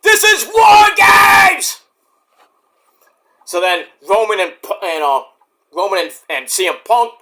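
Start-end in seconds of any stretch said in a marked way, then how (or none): 0.0-1.8 high, loud, slow indignant yell; mock outraged shout. A viewer yelling at Heyman's arrival, loud and slow. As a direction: protest it at the screen, drawn out. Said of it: "I was like".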